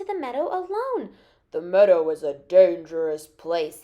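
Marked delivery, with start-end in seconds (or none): 1.5-3.8 put-on low, loud scolding old-man voice; angry grandfather. A narrator's reading. Grandfather scolds Peter for leaving the garden, angry and low. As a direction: scold sternly, deep and loud. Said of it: "He was angry with Peter".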